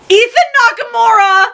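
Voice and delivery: high, loud wail of the name; anguished wail. Real, not acted. Fan wailing a beloved character's name. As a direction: cry out the name in fan grief.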